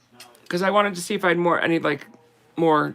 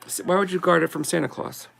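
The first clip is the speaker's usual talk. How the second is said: normal talking voice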